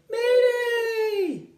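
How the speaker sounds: very high, loud squawking bird cry; bird's cry. Acted. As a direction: screech it like a panicking little bird. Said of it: "The wiffle bird cried".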